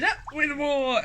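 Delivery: high-pitched war cry; narrated shout. A narrator's reading. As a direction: call it out as a rallying slogan. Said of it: "And they shouted".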